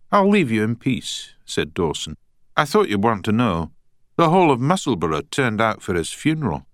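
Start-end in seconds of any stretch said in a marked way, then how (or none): 0.1-1.3 put-on Scottish accent. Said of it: "in a strong Scottish accent". 2.6-6.7 put-on Scottish accent. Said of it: "in a strong Scottish accent".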